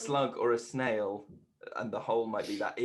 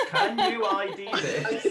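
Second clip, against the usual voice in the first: high, loud, drawn-out imitation of the riddle voice; laughing, hammed-up tagline; mock slogan; 7 semitones higher, 5 dB louder, slower than usual. Friend teasing the quizmaster with her own line, mock slogan voice. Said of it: "you've got your slogan tagline".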